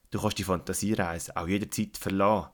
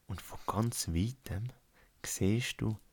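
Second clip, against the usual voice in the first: guided meditation. Acted. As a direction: lead a calm relaxation, unhurried. Guided relaxation fantasy journey.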